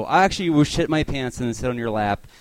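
usual speaking voice

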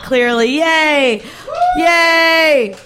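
normal talking voice

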